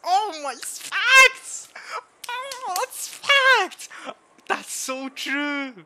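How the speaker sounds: very high, loud, stretched-out wail; wailing agreement. Real, not acted. Reader seeing their own life story, high wailing agreement. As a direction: cry it out in high, drawn-out recognition. Overcome by relatable line.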